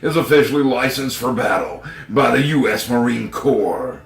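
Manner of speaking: loud, punchy ad read; commercial voice. Acted. Reading the knife ad like a commercial announcer. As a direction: sell it like a TV spot. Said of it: "I should be doing commercials".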